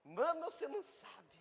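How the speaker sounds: high squealing burst of news; excited woman. Retold or imitated. One woman telling another the news, high and gushing. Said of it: "vai dar uma notícia pra mulher".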